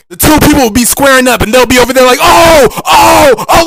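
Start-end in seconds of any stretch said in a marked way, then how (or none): 2.2-3.6 mock yelling. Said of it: "people that are just yelling".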